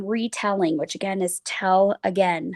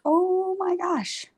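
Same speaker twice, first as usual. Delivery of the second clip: mock gasp; 9 semitones higher, slower than usual. Little Red sees wolf.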